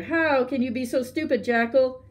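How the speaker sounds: a touch of storybook tiger outrage; bellowing anger. A narrator's reading. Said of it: "bellowed the tiger".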